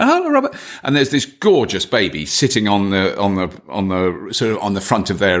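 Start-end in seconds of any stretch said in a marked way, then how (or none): 0.0-0.5 very high, bright hello; sing-song receptionist greeting. Retold or imitated. Receptionists greeting Robert at the desk, high sing-song. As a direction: chirp it like a receptionist greeting a regular. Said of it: "two women behind the reception desk going".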